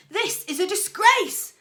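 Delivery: loud, high bellow of disgust; bellowed outrage. A narrator's reading. Old man bellowing at the football match. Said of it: "bellowed Mister Hawtrey".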